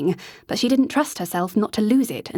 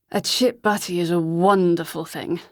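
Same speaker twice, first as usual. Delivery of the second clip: put-on slurring drunk; 2 semitones lower than usual. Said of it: "slurring his words".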